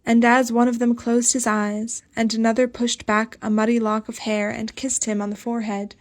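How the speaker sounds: ordinary delivery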